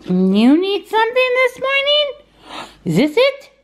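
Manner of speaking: high, slow, cooing pet voice. Real, not acted. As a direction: coo sweetly at the pets. Greeting pets in the morning.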